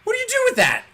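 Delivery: high, strained exasperated question. Acted. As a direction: plead helplessly after the child's noise. Exasperated at son's pronunciation.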